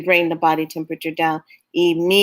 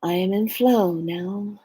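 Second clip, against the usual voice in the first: mock serene; 5 dB quieter than usual. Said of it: "it's like".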